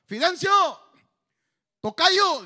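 high, loud calling shout; joining the search party, yelling for his namesake. Retold or imitated. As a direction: bellow it across the dark hills. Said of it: "él también gritaba".

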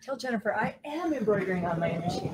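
softly, as a side remark to a co-host; quiet aside. Real, not acted. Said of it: "You tell her".

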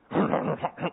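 low throaty brute grunt; mock ogre noises. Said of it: "these big ogres".

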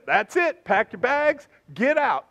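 loud, high mock order to leave; mock stern dismissal. Father disowning his child, acted loud, stern dismissal. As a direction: act out a father throwing someone out.

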